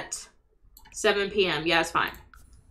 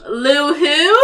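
normal talking voice

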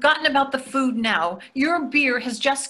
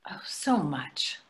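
wistful whisper; 6 semitones lower, 8 dB quieter, slower than usual. Real, not acted. Wife sighing over absent kids.

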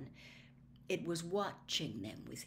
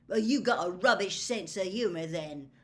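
louder, curt, rude retort; snapping; 8 dB louder than usual. A narrator's reading. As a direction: snap it back rudely. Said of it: "Ron snapped".

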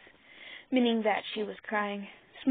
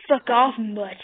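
hissed; 9 dB louder, slower than usual. A narrator's reading. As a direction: hiss it with venom. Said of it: "he hissed into the dog's ear".

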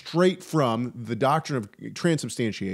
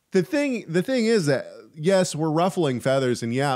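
ordinary talk, nothing marked

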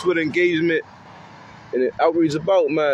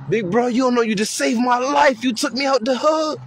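higher, lightly voiced grateful kid; a kid thanking big bro, pitched up; 6 semitones higher than usual. Kid in the car tells big bro he saved him, higher.

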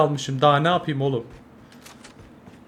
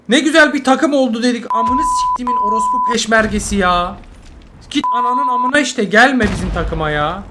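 loud, higher swearing tirade; angry tirade; 6 semitones higher, 4 dB louder than usual. Real, not acted. Gamer telling bad teammates not to join his team, angry tirade. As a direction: keep ranting at the team.

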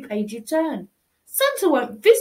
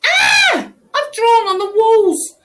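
high loud horrified cry; loud high shocked outcry; shocked shout; 8 semitones higher, 6 dB louder, slower than usual. Acted. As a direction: gasp aloud at the damage. Child finds marker on walls.

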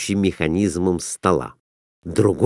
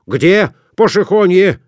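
loud, higher, insistent; loud merchant; 9 semitones higher, 4 dB louder than usual. A narrator's reading. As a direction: push the bet on the croupier. Merchant demanding.

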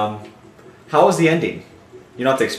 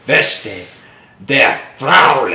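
mock German accent, harsh, getting louder; 3 semitones higher, 7 dB louder, much slower than usual. Mimicking how a German lady sounds, harsh mock German. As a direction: spit out fake German like punches. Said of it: "German's so harsh".

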